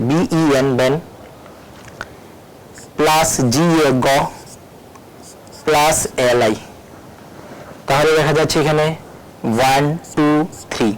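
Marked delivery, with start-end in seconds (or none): 0.1-6.5 pauses around each "plus", separating "বেন", "গ" and "এল আই"; syllable-by-syllable spelling. Shown as a demonstration. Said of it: "কিরকম করে এটাকে ভাগ করা হচ্ছে". ("বেন", "গ", "এল আই" থেমে থেমে ভাগ করে বলা)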